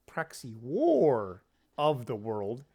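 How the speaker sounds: slow, carefully separated correction, stretching "war"; slow down and specify each word; drawn-out self-correction; slowed-down speech. Real, not acted. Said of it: "slow down our speech".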